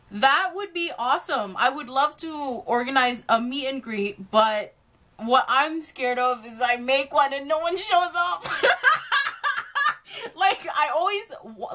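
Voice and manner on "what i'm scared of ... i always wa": giggling through the words, a bit higher; nervous laughing. Real, not acted. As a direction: laugh at your own fear as you speak. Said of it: "I don't know why I'm laughing so hard".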